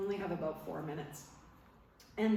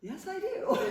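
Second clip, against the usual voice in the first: much higher, laughing yes; relieved laugh; 7 semitones higher, 4 dB louder than usual. Retold or imitated. Telling Mel Gibson she feels better, laughing high. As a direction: answer bursting into relieved laughter. Relieved answer after being calmed.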